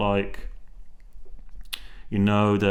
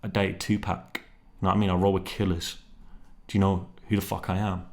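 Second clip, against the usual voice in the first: low-key stern retort, softer than usual; 3 semitones lower, 6 dB quieter than usual. Retold or imitated. Imagined wife to her husband after being mocked, stern. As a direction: imitate a stern, quietly put-out wife. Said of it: "stern, she's like".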